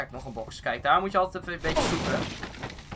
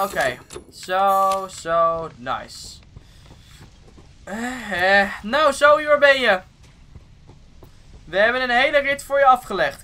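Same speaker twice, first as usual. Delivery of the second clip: normal talking voice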